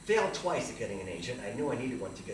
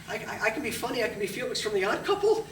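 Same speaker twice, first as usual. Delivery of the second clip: high, loud nervous eagerness; anxious high plea to the agent; eager pleading; 9 semitones higher, 8 dB louder than usual. Retold or imitated. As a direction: rush to prove yourself funny.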